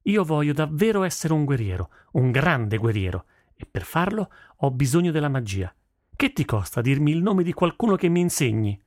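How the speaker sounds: put-on high-pitched, insistent girl character; insistent daughter. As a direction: press your father for help. Daughter pleads to learn magic. (figlia insistente)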